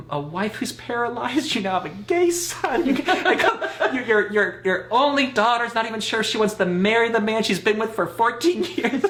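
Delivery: high, loud, mounting protest with laughter; mock exasperated outburst. Son retells confronting dad about family, comic exasperation. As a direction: pile up the list in comic exasperation.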